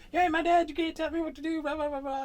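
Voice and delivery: high, loud mocking shriek of a teen sister; retold screaming. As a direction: screech it like a furious teenager throwing a fit. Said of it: "starts screaming at me".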